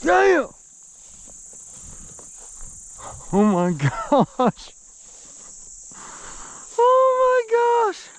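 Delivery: very high, loud yells with a laugh; bursting with delight; joyful shouting. Real, not acted. Releasing the bass.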